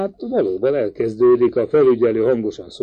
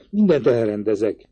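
ordinary delivery, nothing marked

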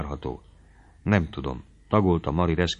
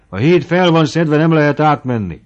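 calling out; 8 semitones higher, 9 dB louder than usual. A narrator's reading. Man calls out about bridge.

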